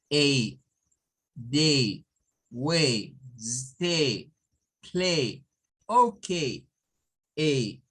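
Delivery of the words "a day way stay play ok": "day", "way", "stay", "play" each drawn out on the long A; long A drill. Shown as a demonstration. Teacher repeats the long A word list. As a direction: model each AY word slowly. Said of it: "Ahí tendríamos".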